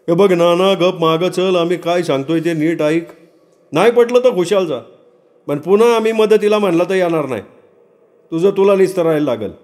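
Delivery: high-pitched village ultimatum; high, impatient kinsman voice; insistent relative. Acted.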